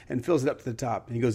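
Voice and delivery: ordinary delivery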